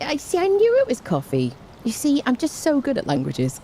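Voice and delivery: high-pitched pretend boasting; mock self-congratulation. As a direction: sing your own praises, tongue in cheek. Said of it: "senses of humour is self-congratulations".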